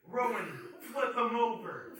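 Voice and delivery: loud, high mock command; imitated bully giving instructions; shouted order. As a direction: bark it out, stretching the order. Headliner shouts order to host.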